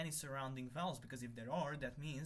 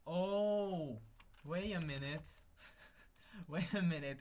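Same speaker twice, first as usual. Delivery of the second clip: louder, higher sudden realization; excited realization; 4 semitones higher, 6 dB louder, slower than usual. Real, not acted. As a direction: it just dawned on you, burst out. Realizing the bug, loud.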